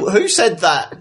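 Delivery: high-pitched, lightly acted challenge; startled character. Game master voices startled character.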